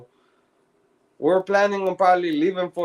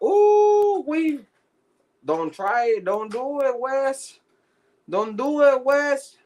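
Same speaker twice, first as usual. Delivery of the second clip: high-pitched teasing plea; mock high warning; 9 semitones higher, slower than usual. Telling Wes not to fight the guard, mock high warning. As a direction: squeal it like a nervous friend pulling him back.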